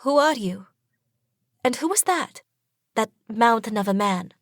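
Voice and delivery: high, trembling questions to a stranger; shaky and scared. A narrator's reading. Fleeing girl asks her rescuer who he is, shakily. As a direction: ask with a nervous, shaky voice. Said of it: "and ask shakily".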